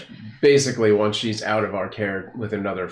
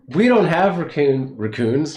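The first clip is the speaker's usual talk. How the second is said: put-on playful Kiwi accent, a bit higher; New Zealand accent; 4 semitones higher, 5 dB louder than usual. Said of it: "That's my New, New Zealand accent".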